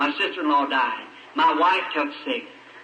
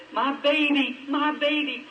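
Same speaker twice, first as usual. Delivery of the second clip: imitated frantic cry; 8 semitones higher, slower than usual. Mother crying for her child.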